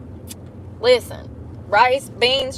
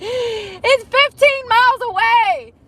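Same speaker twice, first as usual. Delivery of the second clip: slow, much higher, through laughter; laughing; 12 semitones higher, 5 dB louder, slower than usual. Retold or imitated. Vlogger laughing at own mistake, slow and high.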